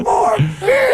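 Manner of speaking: high shouted demand; drunken shout. Acted.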